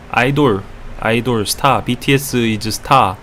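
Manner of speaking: put-on halting Korean-accented English; questioning Korean accent, one word at a time. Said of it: "with the Korean accent".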